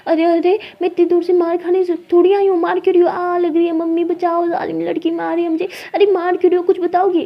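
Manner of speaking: high, loud playful yelping; hammed-up cry for rescue; mock distressed protest. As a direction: plead as if under a play beating. Playfully protests being beaten.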